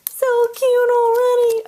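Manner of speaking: very high, loud squeal of delight; cute voice. Acted. Crafter squealing at her butterfly card in cute voice. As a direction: squeal it like a cooing toddler. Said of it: "I'm doing my cute voice".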